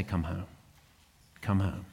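low, soft, gentle invitation; tender call. Retold or imitated. Pastor voicing God calling a straying believer, soft and tender.

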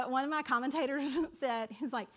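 normal talking voice

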